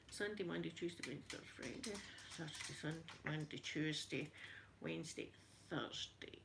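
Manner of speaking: fading from soft to a whisper; counting under breath. Real, not acted. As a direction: count the days quietly, almost to yourself.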